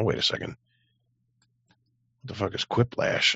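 ordinary talk, nothing marked

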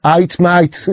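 eight and mate as Brummie "ite, mite"; Brummie accent. Retold or imitated. Streamer imitating his mate at voice typing, Brummie accent. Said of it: "doesn't understand a Brummie accent".

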